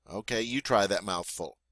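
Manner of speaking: ordinary talk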